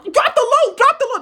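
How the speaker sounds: very high, loud urgent yell; shouted command. Retold or imitated. British ordering fire on the king, high shouting.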